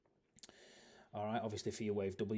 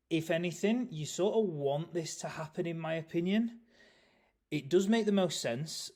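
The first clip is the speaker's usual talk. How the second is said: talking through a yawn; stretched, yawning words; 8 semitones higher, 9 dB louder than usual. Real, not acted. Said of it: "Sorry, I keep yawning".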